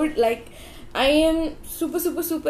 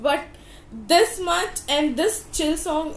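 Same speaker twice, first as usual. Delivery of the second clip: usual speaking voice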